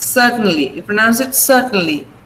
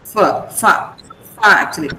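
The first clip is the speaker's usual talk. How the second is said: ordinary talk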